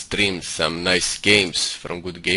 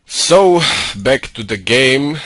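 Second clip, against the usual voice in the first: loud; 6 semitones higher, 8 dB louder, slower than usual. Acted. Snapping back to casting.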